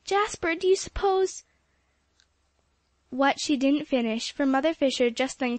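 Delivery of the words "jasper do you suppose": high, worried, breaking off; Polly fears bad news of the boys; anxious breathless appeal. A narrator's reading. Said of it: "gasped Polly".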